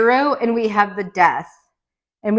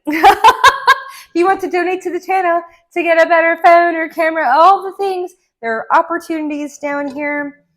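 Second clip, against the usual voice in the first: laughing, higher, louder donation pitch; laughing plea; 7 semitones higher, 5 dB louder than usual. Shown as a demonstration. Reader jokingly asks viewers to donate, laughing. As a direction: giggle through the cheeky ask.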